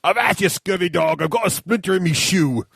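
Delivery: light pirate accent, a hint of an old sea dog growl. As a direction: play a salty pirate, only lightly. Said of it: "In your best pirate accent".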